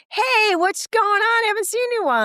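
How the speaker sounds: high-pitched mock greeting from a window; friendly shout-out. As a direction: call across the street cheerfully.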